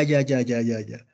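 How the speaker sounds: ordinary delivery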